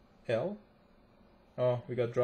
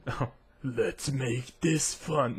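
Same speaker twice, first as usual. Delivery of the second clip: slow, drawn-out character voice; attempted champion impression; much slower than usual. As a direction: try a slow game-champion voice, not quite landing it. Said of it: "I can't do it".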